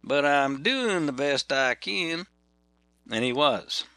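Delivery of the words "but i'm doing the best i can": slow, long drawn-out Georgia drawl. Retold or imitated. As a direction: stretch every word like a Georgia boy. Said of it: "in a long, drawn-out Georgia brawl".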